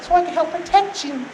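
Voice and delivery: high put-on sweetheart voice; coy flirt. Delilah promising to protect Samson, coy wheedling. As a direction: wheedle in a high mock-feminine voice. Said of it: "batting her eyes like we all know".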